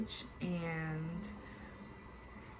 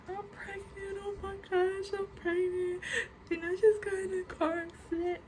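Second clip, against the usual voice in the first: much higher, tearful panicked cry; 12 semitones higher than usual. Retold or imitated. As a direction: relive the sobbing panic, high and strained. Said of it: "I start crying".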